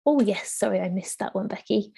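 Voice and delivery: usual speaking voice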